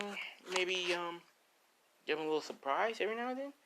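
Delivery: high-pitched, coy musing; hint at it in a high girl's voice; playful high voice, thinking aloud; Lisa. Acted. Lisa coyly hints at surprise.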